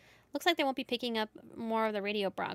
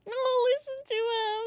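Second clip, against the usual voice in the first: cooing; 12 semitones higher, 3 dB louder than usual. Real, not acted. Cooing over purring cat.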